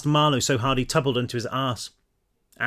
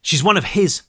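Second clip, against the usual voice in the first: higher, angry retort; spat out; 5 semitones higher, 4 dB louder than usual. A narrator's reading. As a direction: spit the words with contempt. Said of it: "she spat".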